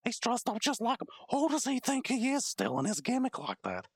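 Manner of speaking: ordinary talk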